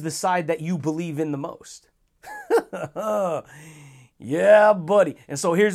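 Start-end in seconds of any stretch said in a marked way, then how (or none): 2.3-3.4 higher, short falling laugh; pleased laugh. Real, not acted. After saying belief decides which side wins, pleased laugh. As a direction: laugh with satisfaction.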